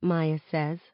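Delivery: ordinary delivery, nothing marked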